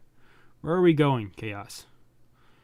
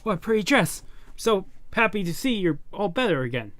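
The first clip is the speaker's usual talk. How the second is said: put-on higher, sweet girlish voice; cheerful girl voice; 6 semitones higher than usual. Girl greets the recovered Alita in a cheerful higher voice. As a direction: voice a bubbly young girl.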